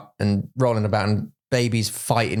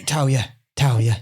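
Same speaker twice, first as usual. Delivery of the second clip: startled waking; 5 semitones higher than usual. Retold or imitated. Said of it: "I'm like".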